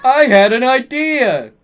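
high, loud eureka cry; bright burst of inspiration; exclaiming. A narrator's reading. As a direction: exclaim it in excitement. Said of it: "he exclaimed".

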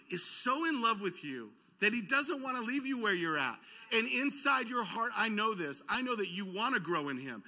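normal talking voice

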